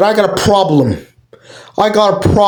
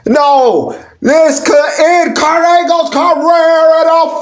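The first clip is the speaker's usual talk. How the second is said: higher, excited play-by-play call; wrestling commentator; 6 semitones higher than usual. Acted. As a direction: call the match like live TV. Live play-by-play of the match.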